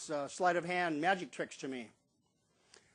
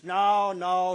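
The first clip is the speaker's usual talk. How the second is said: loud, drawn-out mock refusal; emphatic refusal; 2 semitones higher, 9 dB louder than usual. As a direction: act out a shopkeeper's firm denial. Shopkeeper dismisses the question.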